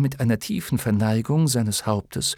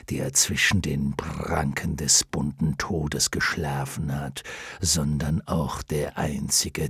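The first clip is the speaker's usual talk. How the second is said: low, slow, fading to whisper; low lion voice sinking to a whisper; 7 semitones lower, 3 dB quieter, slower than usual. Acted.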